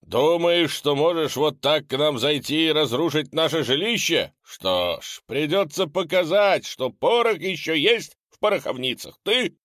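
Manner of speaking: high, loud furious challenge; angry shout. Acted. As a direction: roar back at the monster. Dex furiously challenging ghoul.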